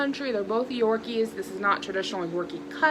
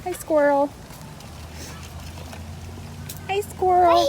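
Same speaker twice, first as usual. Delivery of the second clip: cutesy animal greeting; 9 semitones higher than usual. Real, not acted. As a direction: coo a hello up high to an animal. Greeting a squirrel.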